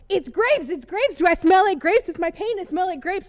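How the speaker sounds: high, giddy, over-excited babble; excited squeal. Retold or imitated. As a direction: gush it out all flustered. Excited fangirl retelling herself.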